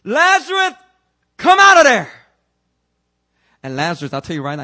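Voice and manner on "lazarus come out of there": high, loud shouted command. Acted. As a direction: call him out of the grave. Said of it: "God did exactly what Jesus did".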